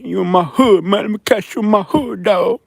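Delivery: high mocking threat. Streamer mimics one of those comments, mocking high tough guy. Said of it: "Went on my channel today".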